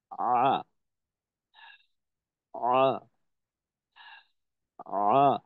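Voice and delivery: আ drawn from the mid-throat, pressed lightly from above; Ain throat sound demo. Shown as a demonstration. Teacher showing students the Ain from mid-throat, pressed throat sound. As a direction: sustain আ with gentle throat pressure. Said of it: "এভাবে করতে পারি".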